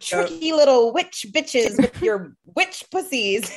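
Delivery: mocking high voice, slowly drawn out; hammed-up aggrieved men accusing women, pitched high; vilified men voice. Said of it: "giving the vilified men that voice".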